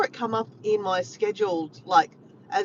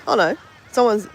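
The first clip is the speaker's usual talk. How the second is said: ordinary talk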